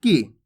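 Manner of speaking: "की" with a brief i sound; short vowel. Shown as a demonstration. Teacher explaining the small i sign, "की" kept short. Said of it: "इसके उच्चारण में कम समय लगता है".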